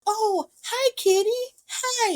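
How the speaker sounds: high-pitched kitty greeting; cooing at a cat. Retold or imitated. Lucy greeting the cat on the counter, high cooing. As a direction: coo sweetly in a high, put-on voice.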